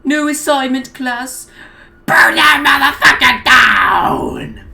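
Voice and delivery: teacher yelling orders at students; villain yell. A narrator's reading. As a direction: rile up the class to burn it down. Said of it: "before yelling".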